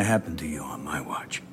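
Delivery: hushed. Real, not acted. Quiet private reassurance.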